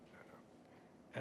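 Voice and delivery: a hushed, breathy no, no; whispered refusal. Retold or imitated. Tim retold turning down the job, whispered. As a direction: whisper the refusal, half-joking.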